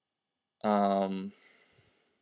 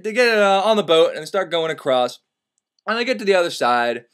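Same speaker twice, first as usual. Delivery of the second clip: normal talking voice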